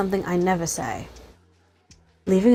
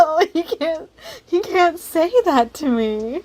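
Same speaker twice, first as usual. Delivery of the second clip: squealing, higher and louder, slow; flustered; 10 semitones higher, 4 dB louder, slower than usual. Real, not acted. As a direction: protest through laughter. Player flustered by Minx.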